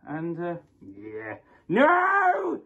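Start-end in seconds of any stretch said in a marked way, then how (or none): 1.7-2.6 high, loud, drawn-out cry of no; dramatic scream. Pretend. Presenter acts out a betrayed outburst, high mock scream. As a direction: wail it in mock anguish.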